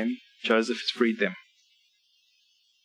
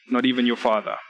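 ordinary talk, nothing marked

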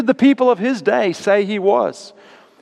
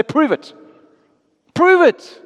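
higher-pitched demand, building on the repeat; challenging demand; 9 semitones higher, 3 dB louder than usual. Acted. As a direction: throw down a challenge, pushing it harder. Acted challenge from a sceptic.

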